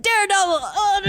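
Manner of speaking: high, loud fan yell; excited outburst. Retold or imitated. Fan pointing out characters at the bar, excited yell. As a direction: blurt it out, reenacting giddy recognition. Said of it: "I was Leo DiCaprio that entire night".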